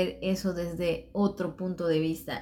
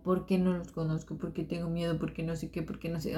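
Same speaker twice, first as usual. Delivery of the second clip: mumbled excuses; 6 dB quieter, faster than usual. Retold or imitated. Her own retold excuses, trailing off.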